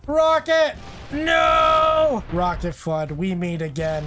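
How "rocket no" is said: high loud warning yell; alarm shout. Real, not acted. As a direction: shout it out in panic. Calls out incoming rocket.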